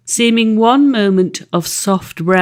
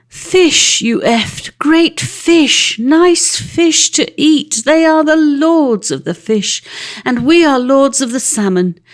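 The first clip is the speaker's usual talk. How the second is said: high, loud, gloating predator; boasting otter; 6 semitones higher, 3 dB louder than usual. A narrator's reading. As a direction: lord it over the little eft. Otter boasts, frightening Tom.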